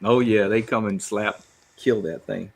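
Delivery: ordinary talk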